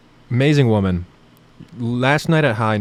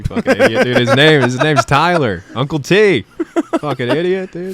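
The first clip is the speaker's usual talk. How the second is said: mock outrage; 5 semitones higher, 5 dB louder than usual. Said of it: "And then I'm just like".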